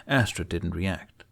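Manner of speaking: usual speaking voice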